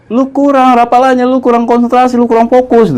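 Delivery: high, scolding list of faults; lecturing brother. Retold or imitated. Brother lecturing him on lacking focus, scolding. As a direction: tick off each mistake sharply.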